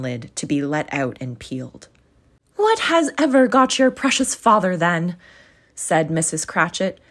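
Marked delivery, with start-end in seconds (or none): 2.6-5.2 higher, louder fretful mother; a busy mother fussing over the late ones; lightly raised, fretting mother's voice; Mrs Cratchit's voice. Acted.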